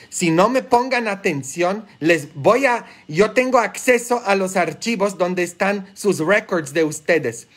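re-enacted stern warning, rising in pitch; retold warning to prisoners. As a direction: threaten to tell their mothers, scolding. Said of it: "les decía".

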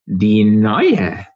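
menacing creature hiss. A narrator's reading. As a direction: hiss the words from under water. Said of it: "zischte es aus dem Wasser".

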